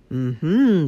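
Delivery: loud, knowing hum; smirking. A narrator's reading. Wolf learning where Grandma lives, smirking. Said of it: "the wolf smirked".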